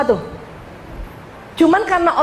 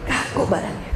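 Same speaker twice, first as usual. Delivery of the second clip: softer, lower repeat of Kaku badannya, dropping away; quiet echo; 4 semitones lower, 8 dB quieter than usual. Shown as a demonstration. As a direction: let Kaku badannya sink into a hushed echo. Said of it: "repeats the phrase for effect".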